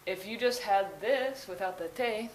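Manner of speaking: usual speaking voice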